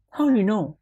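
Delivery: put-on high doubtful little boy; child voice. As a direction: ask like a worried child. Son questioning father.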